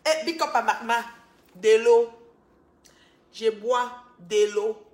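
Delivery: loud, drawn-out banter; loud shout-out to Magma; playful loud. Pretend. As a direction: call it out loud to the chat. Loud live banter, drinking water.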